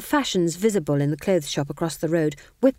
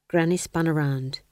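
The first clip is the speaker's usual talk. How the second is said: ordinary delivery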